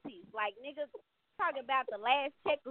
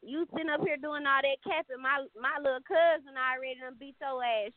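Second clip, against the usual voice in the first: usual speaking voice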